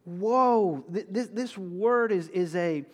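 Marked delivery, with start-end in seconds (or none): 0.0-0.9 weighty, raised woe, woe; solemn prophetic woe; heavy woe. Shown as a demonstration. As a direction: lean into each woe. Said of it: "he's saying".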